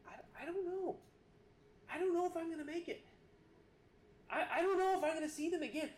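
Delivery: retold panic. Preacher recalling fear far from shore, rushed and frightened. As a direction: relive the panic of being swept out.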